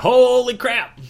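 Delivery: high, loud startled yell; shocked shout. Retold or imitated. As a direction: blurt it out in shock. Characters shocked as he falls.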